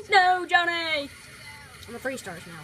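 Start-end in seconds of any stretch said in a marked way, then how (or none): 0.0-1.1 long, loud, high wail of protest; dramatic cry. Pretend. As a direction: cry out in drawn-out mock despair. Crying out as game character fails.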